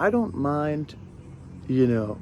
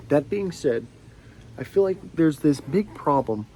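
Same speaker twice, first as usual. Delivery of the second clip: ordinary delivery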